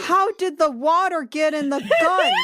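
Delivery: demanding an answer from the reader; shouted disbelief. Real, not acted. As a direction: yell the question in disbelief.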